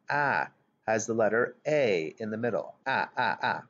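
short vowel "ah" in cat, stretched and repeated; short a sound. Shown as a demonstration. Teacher showing children the middle sound in cat. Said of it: "which makes the ah sound".

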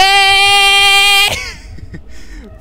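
high, loud startled laugh-cry; shrill scared laugh. Real, not acted. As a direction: laugh out of fright.

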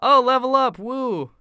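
loud, high celebratory whoop; thrilled gamer's outburst; excited cheer. Real, not acted. Cheers levelling up in game.

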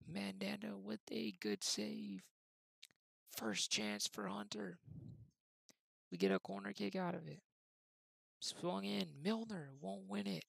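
commentator calling the match; live play-by-play. Acted. Gamer calling his own match like a TV commentator, live play-by-play. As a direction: call it like a TV football commentator.

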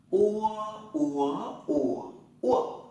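"Ua" gliding u into a rounded vowel, through four tones; o read as "ua". Shown as a demonstration. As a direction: drill "ua" high, rising, dipping, then falling. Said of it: "O đọc là ua nhá". (đọc "ua" qua bốn thanh điệu)

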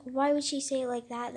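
ordinary delivery

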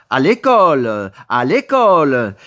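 loud, slow, firm command; firm order. Acted. Teacher ordering the boy to school, loud and slow. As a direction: drive it home like an order.

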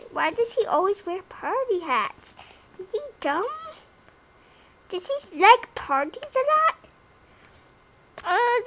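ordinary talk

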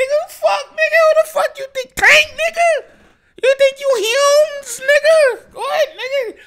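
high, loud playful challenge; hammed-up shouting at the singer; mock hype yelling. Hyping himself up at the singer, mock yelling.

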